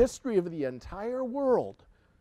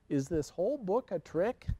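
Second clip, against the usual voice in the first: ordinary delivery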